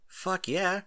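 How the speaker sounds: a quick private fist-pump of a line; excited. Acted. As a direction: cheer it to yourself. Thrilled at being paid.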